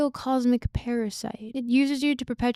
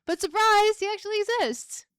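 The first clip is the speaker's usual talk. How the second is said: high, loud surprise; spring the reveal cheerfully; playful reveal; 8 semitones higher, 6 dB louder than usual. Real, not acted. Host revealing the author exists, high bright surprise.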